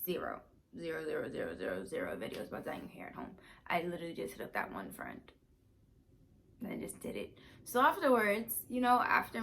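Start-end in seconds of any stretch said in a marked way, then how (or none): 0.0-1.9 repeated emphatic zero; стресс на каждом zero; insisting zero. Real, not acted. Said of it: "I promise you". 1.9-7.4 whispered aside. Real, not acted.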